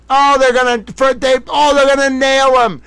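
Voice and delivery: can barely contain the glee; gleeful. Real, not acted. Gleeful over prosecution news.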